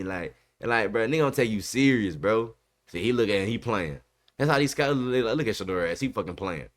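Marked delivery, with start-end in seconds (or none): none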